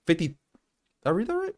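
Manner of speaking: shoots way up in disbelief; can't believe the number; stunned disbelief. Real, not acted. Rereads, stunned at the figure.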